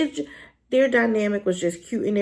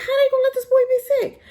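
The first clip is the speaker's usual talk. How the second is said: high, loud, fast anguished protest; distraught outcry; 5 dB louder, faster than usual. Retold or imitated. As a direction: wail it at the writers, pitched way up. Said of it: "I said".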